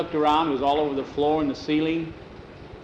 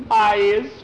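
high, loud character answer; sheepish admission; 7 semitones higher, 6 dB louder than usual. Acted. Skeeter admits he will clean the ceiling, sheepish admission.

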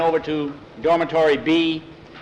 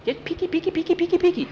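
mocking singsong; faster than usual. Skeeter mocking the bully.

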